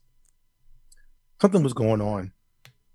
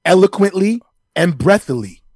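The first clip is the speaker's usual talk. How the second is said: louder, higher pundit boast; Stephen A Smith impression; 4 semitones higher, 7 dB louder than usual. Pundit defending his made-up word, loud boastful impression. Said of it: "you do talk, um, with a lot of breath".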